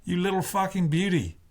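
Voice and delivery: high-pitched, hammed-up Aussie cheer; mock an Australian, badly and high; mock Aussie accent. A narrator's reading. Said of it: "he mocks in his awful Aussie accent".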